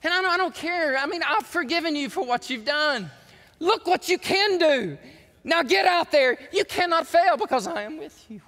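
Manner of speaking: high, loud, impassioned preaching; rally them with God's assurance; rousing pulpit proclamation, pushed up in pitch; voicing God's encouragement. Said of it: "God says".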